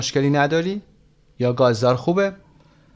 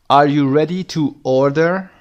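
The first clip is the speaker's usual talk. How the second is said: slow demo; 3 semitones higher, 5 dB louder, much slower than usual. Shown as a demonstration. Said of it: "teacher drills the dialogue line".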